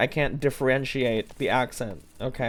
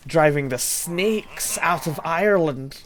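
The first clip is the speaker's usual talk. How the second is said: slow, hammed-up Irish lilt; an Irish accent that slides toward Scottish; attempted Irish accent; 2 semitones higher, slower than usual. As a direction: put on a mock Irish accent, slowly. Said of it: "That's Scottish".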